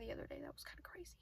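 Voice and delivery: breathy whispered aside. Real, not acted. Recalling something odd, she whispers to herself. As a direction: drop to a whisper, thinking aloud.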